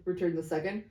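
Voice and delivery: usual speaking voice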